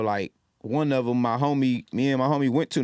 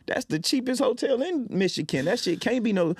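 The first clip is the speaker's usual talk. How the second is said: much higher, outraged disbelief; shocked protest; 11 semitones higher than usual. Retold or imitated. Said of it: "We like".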